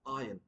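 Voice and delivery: Iron as "eye-un"; correct pronunciation. Shown as a demonstration. Teacher closing the drill, Iron as "eye-un". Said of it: "R would be silent".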